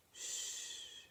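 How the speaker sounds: whispered hush, very soft; soft shush. Acted. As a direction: soothe her with a breathy shush. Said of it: "said Vic's mother".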